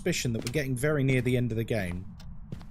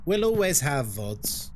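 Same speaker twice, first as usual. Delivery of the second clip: slow mock-dramatic line. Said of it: "there's always vods".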